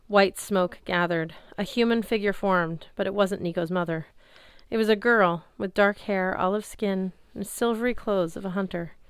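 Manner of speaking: ordinary delivery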